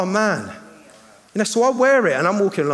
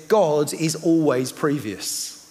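slow; 4 semitones lower, much slower than usual. Acted. Quoting slowly for weight.

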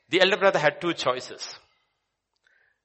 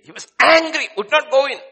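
higher, louder declaration; emphatic shout; 12 semitones higher, 10 dB louder than usual. Acted. Preacher proclaims son's angry refusal, emphatic shout. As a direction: proclaim his anger forcefully.

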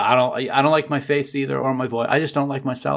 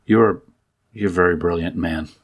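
usual speaking voice

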